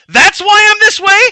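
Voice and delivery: very high, loud anguished yell. Acted. Lucky shouting at Trent before running off, high loud yell. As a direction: scream it out in despair. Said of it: "He yells".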